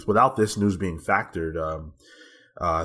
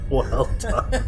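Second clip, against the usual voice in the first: laughing; 11 semitones higher than usual. Real, not acted. Repeating a compliment, laughing.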